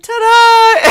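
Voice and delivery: high, loud triumphant ta-da; kid's ta-da. Retold or imitated. Four-year-old finishing his trick, loud high ta-da. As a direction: present the trick like a proud little kid. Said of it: "and be like".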